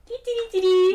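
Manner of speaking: high, loud "dee-dee-dee" jingle; playful jingle. Pretend. Game master to players as the journey starts, playful high jingle. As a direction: chirp out a little travel fanfare.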